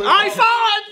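high, thrilled cry of I saw it; excited yelp. Retold or imitated. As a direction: squeal like you just caught a glimpse. Acting excited strip club guest.

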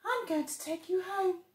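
a kind tiny animal; bush baby voice. Acted. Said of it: "said Bush Baby".

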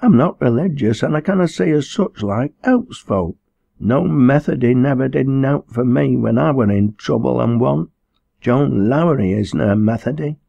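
high-pitched tearful dialect protest; aggrieved sobbing. Acted. Girl complaining that no Methody helped her, tearfully aggrieved. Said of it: "sobbed Liz aggrievedly".

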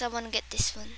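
usual speaking voice

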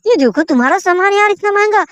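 ordinary talk

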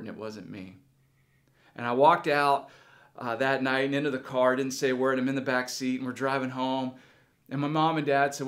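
ordinary delivery

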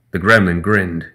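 ordinary delivery, nothing marked

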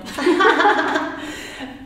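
high, loud burst of laughter. Real, not acted. Reacting to the host's Forrest Gump joke with a loud laugh. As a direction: let out a big real laugh.

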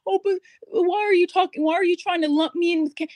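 lightly mocking pushback; mock protest. Mimics the audience's objection.

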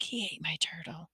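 breath-only confiding whisper. A narrator's reading. Boy telling a woman about his brother, whispered. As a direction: whisper it as a secret. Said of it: "I whispered back".

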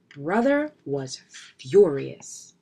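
plain reading voice

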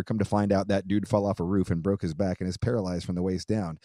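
normal talking voice

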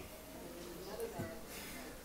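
high soft embarrassed giggle; nervous laugh. Acted. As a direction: laugh off the awkward moment. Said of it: "I'm so awkward".

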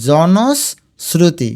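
জনশ্রুতি very slowly as jonos, sruti; long pause inside জনশ্রুতি; broken into parts. Shown as a demonstration. Teacher shows students the sibilant, জনশ্রুতি slowly split. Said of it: "উচ্চারণ চ এর মতো হয়েছে".

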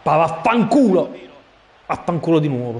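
loud, high furious swearing; explosive italian cursing. Real, not acted. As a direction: curse out loud at the miss. Cursing a missed chance.

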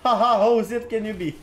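loud, high mock laughter into a taunt; mocking jeer. Real, not acted. Streamer scoffing at a player in all chat, loud mocking jeer.

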